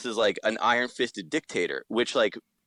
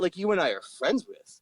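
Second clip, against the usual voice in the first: usual speaking voice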